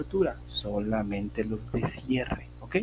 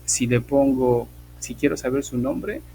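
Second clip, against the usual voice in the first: normal talking voice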